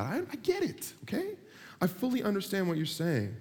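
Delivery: pleading, pitch shot way up; exasperated God. Acted. God to someone over-praying, exasperated.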